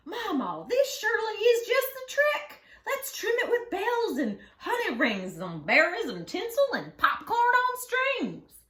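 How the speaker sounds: put-on high, loud eager child begging Mama; excited child voice. Barnaby begs Mama to trim the treetop, high and eager. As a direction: bounce it like a thrilled little boy.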